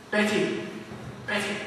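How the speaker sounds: hushed call to a fellow hunter; acted stealthy lioness; furtive call. Lioness secretly signals her companions.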